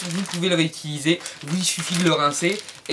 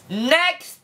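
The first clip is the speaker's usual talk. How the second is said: shouted announcement; 10 semitones higher than usual. Real, not acted. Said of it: "j'entends me crier chez moi". (annonce criée fort et aiguë)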